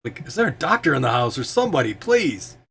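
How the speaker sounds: injured man pleading; wounded, needy Walrus. Acted. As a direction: beg for a doctor, rising high on the last word.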